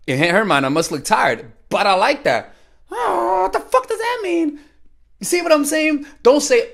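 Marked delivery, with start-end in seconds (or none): 2.9-4.6 mock confused woman. Mimicking the woman's confused reply.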